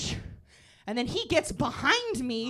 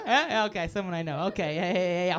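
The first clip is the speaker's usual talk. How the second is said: usual speaking voice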